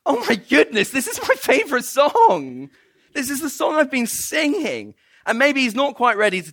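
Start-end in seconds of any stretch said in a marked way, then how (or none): 0.0-4.9 high, louder, laughing delight; excited realization. Retold or imitated. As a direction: burst out with joyful discovery. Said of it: "he's thinking".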